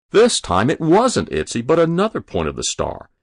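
usual speaking voice

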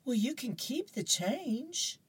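a mother baiting her son; mom voice. Acted. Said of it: "Mom goes".

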